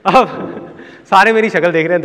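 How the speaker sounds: ordinary delivery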